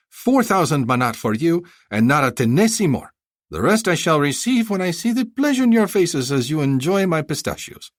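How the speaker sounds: high, effusive haggling vendor; a flattering bazaar seller pitching; eager merchant. Acted. Vendor closing deal.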